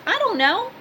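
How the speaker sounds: "I don't know" jumps high and rises at the end; high rising shrug. Shown as a demonstration. Shrugging off gossip about Bob, high and rising. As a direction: toss it off in a high, questioning lilt.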